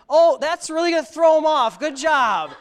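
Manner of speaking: hammed-up sarcastic "good job"; sarcastic clerk. Clerk mocking the fake address, loud sarcasm. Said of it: "He goes".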